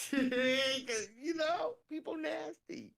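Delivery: real laugh-cry, pitch up high. Real, not acted. Telling her friend about nasty cooks, laughing hard. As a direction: squeak it out through laughter. Laughing while dissing food.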